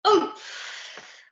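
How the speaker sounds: sharp, breathy grunt of being hit; winded gasp. Acted. Pirate gets landed on and gasps. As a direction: get knocked winded. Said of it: "gasped Bully Boy McCoy".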